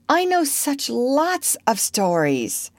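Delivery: high, drawn-out coaxing plea; entreating. A narrator's reading. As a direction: tempt him to stay, slowly. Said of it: "she entreated".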